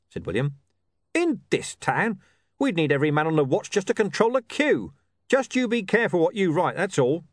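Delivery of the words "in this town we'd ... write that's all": incredulous officer, higher-pitched; scornful watch commander. Acted. Commander rejects the reporter's offer, scornful and warning. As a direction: scoff at the idea, then warn him. Vimes scoffs at William's offer.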